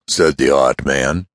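plain reading voice

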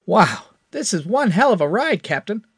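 put-on high, loud young hotshot gushing; thrilled by the ship, let it burst out; excited. A narrator's reading. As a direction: Crash marvels at the ship, excited. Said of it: "Crash said excitedly".

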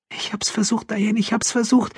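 high, distressed pleading; trembling voice. A narrator's reading. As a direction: repeat it helplessly, in shock. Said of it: "meldete sich mit zitternder Stimme".

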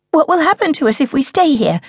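high, fast, anxious question; frightened daughter asking what happens if they stay; panicky child. Acted. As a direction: let panic push the words. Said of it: "thrum of panic in her voice".

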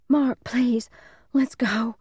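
put-on high, pleading child's voice; pleading little boy. A narrator's reading. Frightened little brother begging big brother to leave, pleading. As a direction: beg in a high kid's voice. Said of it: "Ricky pleaded".

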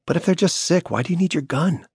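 put-on a sharp young daughter questioning her father; worried little girl. A narrator's reading. Daughter voiced by narrator.

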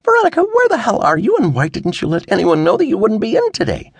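high, exasperated dad; every bit the reproachful father; scolding father. A narrator's reading. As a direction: demand answers like a worried parent. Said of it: "sounding every bit like a father".

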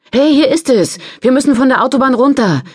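urgent shout, high and loud; calling out. Acted. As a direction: shout the exit to the driver. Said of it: "rief sie".

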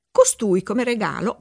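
plain reading voice

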